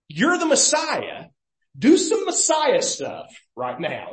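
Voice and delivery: high-pitched mock challenge to prove it; mocking demand. Preacher voicing the defiant thief's challenge to Jesus, mocking demand. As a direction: imitate an accusing, insistent taunt. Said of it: "There's a mocking in the tone".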